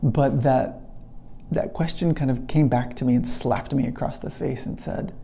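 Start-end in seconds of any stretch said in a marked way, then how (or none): none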